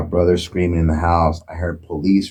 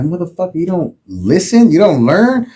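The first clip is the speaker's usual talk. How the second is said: raised, louder furious yelling; mock yell at brother; angry yell; 11 semitones higher, 6 dB louder than usual. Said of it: "I'm like".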